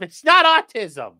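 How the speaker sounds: loud, high, drawn-out denial; mock indignant protest. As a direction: protest loudly with a long stressed NOT. Mock protest at chat's teasing.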